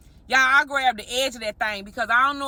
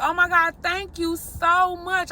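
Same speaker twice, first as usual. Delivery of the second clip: grateful; 6 semitones higher, slower than usual. Retold or imitated. Thanking stranger outside store.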